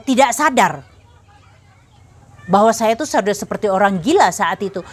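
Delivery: ordinary talk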